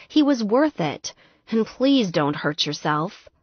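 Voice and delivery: ordinary delivery, nothing marked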